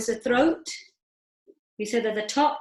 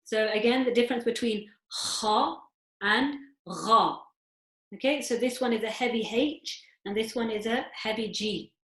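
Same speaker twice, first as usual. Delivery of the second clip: ordinary delivery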